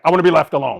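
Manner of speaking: loud fed-up declaration. Retold or imitated. Mock antisocial declaration.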